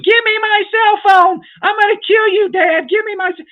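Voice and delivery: put-on shrieking high and loud like a furious kid; a kid screaming at Dad for the cell phone. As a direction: throw a teenage tantrum over the phone. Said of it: "when your kids start yelling and screaming".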